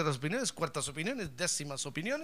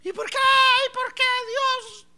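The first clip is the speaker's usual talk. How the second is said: high, slow wailing cry of why; crying woman wails to God, high and drawn out; despairing wail; 10 dB louder than usual. Retold or imitated. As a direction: plead with God through tears, much higher.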